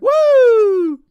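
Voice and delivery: loud high whoop; cheer. Real, not acted. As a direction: whoop like a fan in the stands. Cheering about tomorrow's match.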